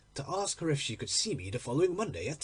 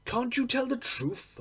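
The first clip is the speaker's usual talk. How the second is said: much higher exasperated exclamation; exclaimed; 8 semitones higher than usual. Acted. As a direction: burst out in frustration. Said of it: "I exclaimed".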